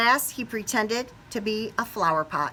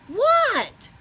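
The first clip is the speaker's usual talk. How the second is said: high, loud startled what; 4 dB louder than usual. Acted. Froggy startled by his teacher, high loud what. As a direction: jump at his name.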